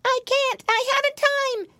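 very high, squeaky little pig; high, childlike panicky reply; fleeing piglet. A narrator's reading. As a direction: squeal it while running from a fox.

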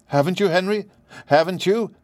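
high, anxious pleading question. A narrator's reading. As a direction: ask in nervous hope. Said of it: "she asked anxiously".

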